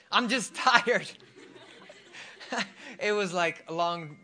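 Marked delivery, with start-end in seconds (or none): 0.1-2.6 laughing. Real, not acted. Breaks up laughing mid-story.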